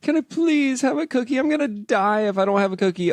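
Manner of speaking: put-on high pleading child voice, dramatic; whiny little girl. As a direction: beg like a desperate little kid. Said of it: "like Vera does".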